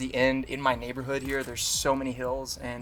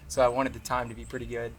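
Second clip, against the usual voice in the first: ordinary delivery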